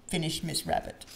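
ordinary delivery

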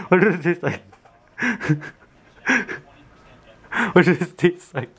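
giggling, high and nervous; laughing. Real, not acted. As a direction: laugh through the question. Laughing before tasting vinegar.

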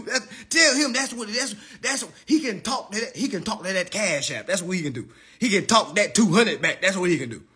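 ordinary talk, nothing marked

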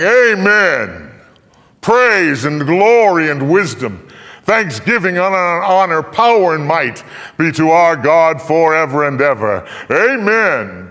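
loud, high, slow ringing praise; exultant praise. A narrator's reading. Angels fallen on their faces praising God, loud and exalted. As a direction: proclaim it like a hymn of glory. Angels worshipping before the throne.

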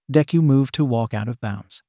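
ordinary delivery

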